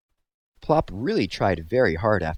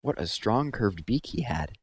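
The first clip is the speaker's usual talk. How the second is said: plain reading voice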